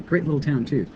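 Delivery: ordinary talk, nothing marked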